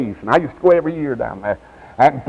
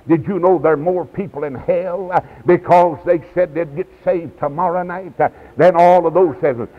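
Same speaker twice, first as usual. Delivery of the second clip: usual speaking voice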